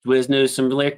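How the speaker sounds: ordinary delivery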